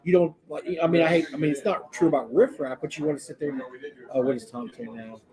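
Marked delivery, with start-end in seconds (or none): none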